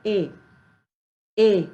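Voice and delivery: ए as the vowel sound of E; phonics E sound. Shown as a demonstration. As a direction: sound out ए alone for letter E. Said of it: "ई का sound क्या होता है". (ए, अक्षर E की ध्वनि)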